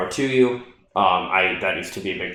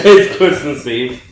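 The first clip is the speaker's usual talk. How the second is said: mocking sing-song echo; 5 semitones higher, 10 dB louder, slower than usual. Echoes partner's sing-song line.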